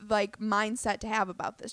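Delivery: ordinary talk, nothing marked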